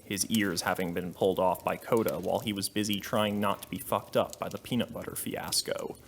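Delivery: plain reading voice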